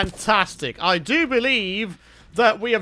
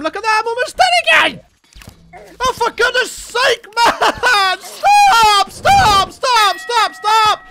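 high-pitched, loud wailing rant; annoyed yelling; 11 semitones higher, 4 dB louder, slower than usual. Real, not acted. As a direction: wail in exasperation, then shout each stop. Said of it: "I'm getting so annoyed".

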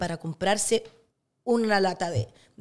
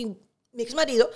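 usual speaking voice

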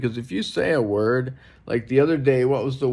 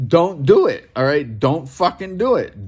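loud, furious command, pitch jumping up; angry shout; 5 semitones higher, 5 dB louder than usual. Real, not acted. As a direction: bark the order like you've had enough. Angry rant at lowercase names.

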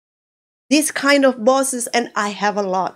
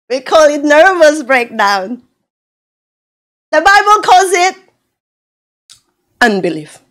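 ordinary delivery

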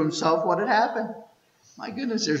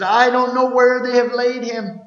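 high, loud desperate plea; desperate cry; 9 semitones higher, 7 dB louder than usual. Acted. Mary cries that she cannot find his body, desperate loud plea. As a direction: wail it out in despair.

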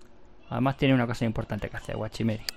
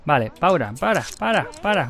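urgent halt; 5 semitones higher, 6 dB louder than usual. Real, not acted. Urgently halting the game.

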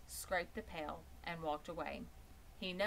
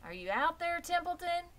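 higher and louder, calling across the yard; 12 semitones higher, 5 dB louder than usual. Acted. Wilbur calls out to Templeton in the rain, high and loud. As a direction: call out into the rain for the rat. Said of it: "called Wilbur".

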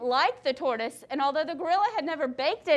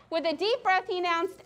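plain reading voice

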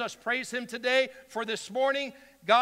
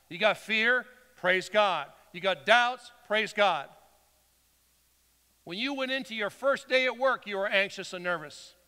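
ordinary talk

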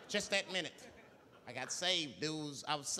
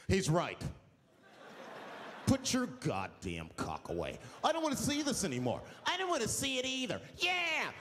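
mock brave bus passengers; 4 semitones higher than usual. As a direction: play the cowardly crowd suddenly bold. Passengers voiced suddenly brave.

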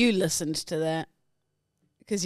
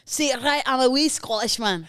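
higher, imitated Scottish accent; 5 semitones higher than usual. Co-host copies host's line, Scottish accent. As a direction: parrot it in a hammed-up Scottish accent. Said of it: "but in the accent".

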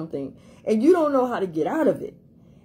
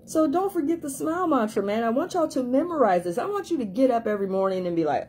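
ordinary talk, nothing marked

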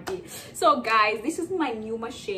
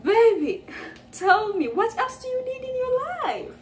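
much higher and louder, swooning; gushing high squeal; 11 semitones higher, 4 dB louder than usual. Real, not acted. Vlogger swooning at her new machine, high squeal. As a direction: coo to the machine like a lover.

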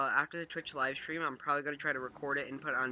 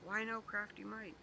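put-on high, slow childish whine; whiny child voice; 8 semitones higher than usual. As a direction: pout like a little kid. Upset at losing a friend.

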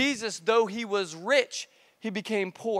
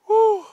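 high, sharp blown-out whew; exaggerated puff to steel yourself; acted high-pitched exhale of dread; bracing whew; 12 semitones higher, 9 dB louder than usual. Bracing himself before the awful job.

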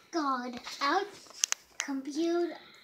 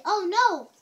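high, louder "oh no"; mock dismay; 5 semitones higher, 9 dB louder than usual. Shown as a demonstration. Child shows the parent how to cry out, high and loud.

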